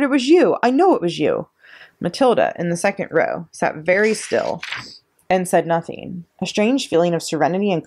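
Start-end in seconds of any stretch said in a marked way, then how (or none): 0.0-1.5 loud, high accusing roar; roared accusation. A narrator's reading. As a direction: pin the blame on her, furious. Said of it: "she roared".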